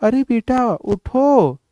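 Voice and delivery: high, slow, soft coaxing call; a hint of an old mother-in-law. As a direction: gently wake her up, drawn out. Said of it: "फिर जाके".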